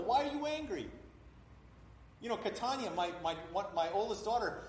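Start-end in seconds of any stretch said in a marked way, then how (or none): 0.0-0.9 high-pitched coaxing question; parent asking child. Acted. Parent asks little son about his anger, high gentle coaxing. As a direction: lift the voice high for a little one.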